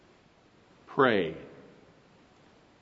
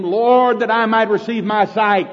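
higher, louder earnest plea; blind man pleading; 7 semitones higher, 7 dB louder than usual. Acted. Blind man answers Jesus, loud earnest plea. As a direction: ask for sight with all your heart.

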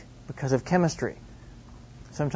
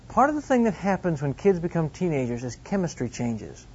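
ordinary talk, nothing marked

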